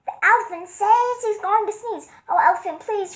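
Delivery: high, piercing parrot squawk; shrieking parrot. Acted. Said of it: "With a shriek".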